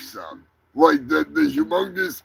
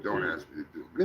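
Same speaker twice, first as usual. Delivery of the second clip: much softer and lower, rushed under the breath; quiet fast mutter; 11 semitones lower, 12 dB quieter, much faster than usual. Real, not acted. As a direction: throw it away quickly to yourself. Muttered repeat of his refusal.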